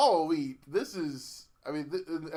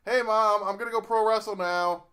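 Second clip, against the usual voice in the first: higher, louder call to mom; mock yell; 5 semitones higher, 5 dB louder than usual. Wrestler's kid self telling mom his plans, mock yell. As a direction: kid calling out to his mother. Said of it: "he yells at his mom".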